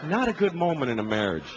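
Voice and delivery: normal talking voice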